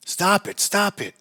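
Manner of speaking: high, loud yell across the room. A narrator's reading. Father yells at his crying baby, loud and high. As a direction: snap at the crying baby to stop. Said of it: "I yelled from across the room".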